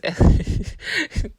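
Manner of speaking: breaking into a high, breathy giggle; real laugh. Real, not acted. Laughing while telling a nightmare about being licked, real giggle. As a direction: crack up mid-sentence at your own story. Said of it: "see, I'm laughing now".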